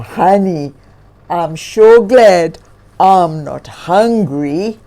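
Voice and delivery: thankful bear talking to himself; grizzly bear voice. Acted.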